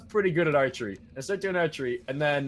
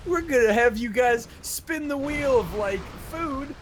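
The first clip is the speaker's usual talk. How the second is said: camp counselor voice; 10 semitones higher, 3 dB louder than usual. Retold or imitated. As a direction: ham up a cheesy camp leader. Mocking camp counselors' announcement.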